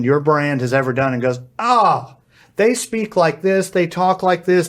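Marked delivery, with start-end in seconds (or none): 1.6-4.7 higher, bright-eyed machine realizing something; lightly voiced AI that has just figured it out; chirpy AI voice. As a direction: play the eager AI assistant catching on. Voicing the AI reading a brand.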